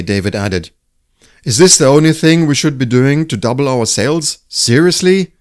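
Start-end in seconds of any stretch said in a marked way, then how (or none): 1.4-5.3 louder, higher and incredulous. Acted. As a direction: push the disbelief up on Seriously. David skeptical about sales.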